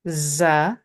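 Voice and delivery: "za" with a thin, sharp Z as in zebra. Shown as a demonstration. Teacher models letters for learners, thin sharp Z. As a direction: buzz a light Z on "za". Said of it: "This is the sharp Z".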